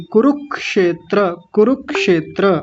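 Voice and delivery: कुरुक्षेत्र spaced out, क्ष crisp; कुरुक्षेत्र slow, joining कुरु to the क; careful demo. Shown as a demonstration. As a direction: say कुरुक्षेत्र syllable by syllable. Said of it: "कुरु के साथ क की आवाज आएगी".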